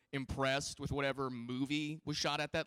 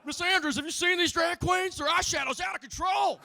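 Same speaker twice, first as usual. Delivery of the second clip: high, loud, ranting senator; worked-up senator; 12 semitones higher, 7 dB louder than usual. Retold or imitated. Comic playing a senator raving to an old lady, high and loud. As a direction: get hotter and higher with every line. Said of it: "the senator's just like".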